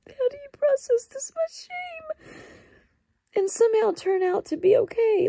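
real crying. Real, not acted. Vlogger asking viewers how to process shame, crying. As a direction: voice breaking high into tears. Breaking down over crippling shame.

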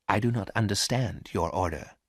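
plain reading voice

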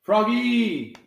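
loud, high call. A narrator's reading. Father calling Froggy from outside, loud and high. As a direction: yell his name to fetch him. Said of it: "Lo llamó su papá".

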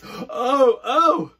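very high, loud mock scream; playful scream. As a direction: squeal as you run away. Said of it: "we all scream and run away".

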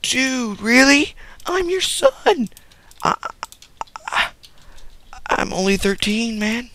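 very high, squeaky pleading; squeaky kid. Retold or imitated. Thirteen-year-old son pleading with his mom, very high and squeaky. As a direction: squeal it like an indignant boy. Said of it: "And I'm like".

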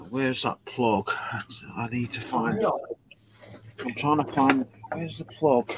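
muttering to self. Real, not acted. Muttering to himself while searching.

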